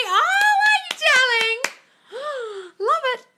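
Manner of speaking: excited high darling; squealing delight. Real, not acted. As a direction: gush with delight. Delighted reply to viewer news.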